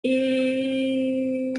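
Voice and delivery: Eh held very long and steady; isolated vowel sound. Shown as a demonstration. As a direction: sustain Eh as the middle sound of a word. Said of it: "Let's say the sounds in pig".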